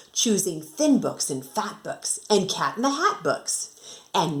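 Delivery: lively singsong storybook reading; reading to children. Acted. Teacher reading a rhyming picture book to her class, lively and singsong. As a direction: bounce the rhyme for young listeners.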